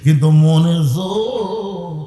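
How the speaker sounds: loud, long-held last word; emphatic drawn-out point. Real, not acted. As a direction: stretch the words out loudly for emphasis. Preacher drives home a contrast.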